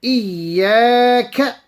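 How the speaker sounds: ordinary talk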